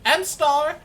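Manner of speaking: put-on very high, loud kid voice; dwarf boy. Dwarf boy adds a name, piping high and loud. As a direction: chime in shrilly like a small boy. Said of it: "Bobby goes".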